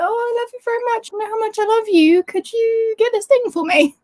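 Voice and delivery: imitating her fiancé's wheedling; wheedling boyfriend. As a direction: sweet-talk for a favour. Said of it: "he's always asking me for little things like".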